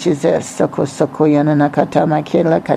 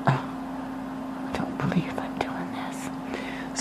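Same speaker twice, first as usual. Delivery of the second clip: barely audible slow whisper; hushed private aside under the breath; 19 dB quieter, much slower than usual. Real, not acted. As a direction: whisper to yourself in disbelief. Private aside amid the prophecy.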